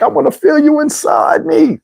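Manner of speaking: high, slow, overwrought plea; mock-wail her desire; imitating yearning woman. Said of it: "She was like".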